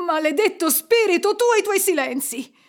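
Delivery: loud, high-pitched outburst; angry curse. A narrator's reading. Scrooge curses the silent spirit, angry and loud. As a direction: hurl it in exasperation.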